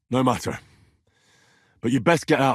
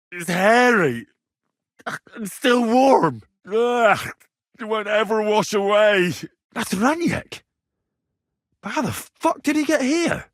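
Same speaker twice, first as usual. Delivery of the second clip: disgusted shock; 9 semitones higher, slower than usual. Acted. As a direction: recoil in horror at the body. Finding a warm hairy corpse.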